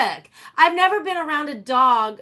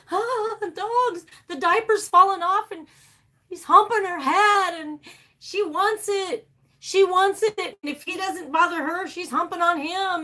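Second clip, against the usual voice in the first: exasperated wailing; 8 semitones higher than usual. Retold or imitated. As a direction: wail the complaints as a hammed-up report. Retelling her complaint to husband.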